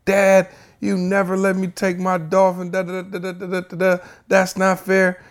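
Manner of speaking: high-pitched mock tantrum, trailing into da-da-da; mad kid. Angry son protesting to dad over the dolphin, mock mad. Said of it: "He was so mad".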